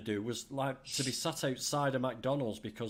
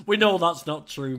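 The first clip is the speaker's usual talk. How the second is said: loud and emphatic, pitched up; 4 semitones higher, 9 dB louder than usual. Real, not acted. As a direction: call it out with a cheer. Teasing reply to a viewer comment.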